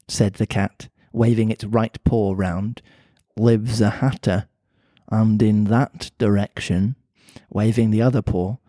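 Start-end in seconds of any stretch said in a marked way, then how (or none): 3.4-7.0 put-on Mancunian accent. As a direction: lower Mancunian cat voice. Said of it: "pretty Mancunian sounding accent".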